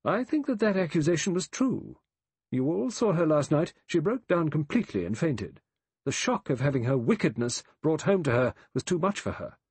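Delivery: high, righteous woman's voice; severe, high-voiced condemnation; stern accuser. A narrator's reading. Same stern woman presses accusation.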